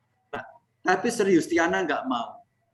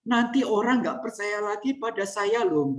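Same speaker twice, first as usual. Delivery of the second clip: a pitched-up boss fussing over his good name; mock anxious superior; fretting boss; 7 semitones higher than usual. Leader fretting about his reputation.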